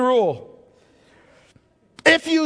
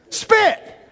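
spit; 11 semitones higher than usual. Acted. Preacher answers own question, dramatic.

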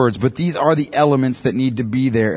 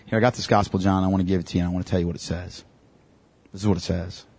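much softer, gentle and sincere; soft earnest offer; 6 semitones lower, 8 dB quieter, faster than usual. Pretend. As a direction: hand over the gospel gently at the door. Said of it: "something along the lines of".

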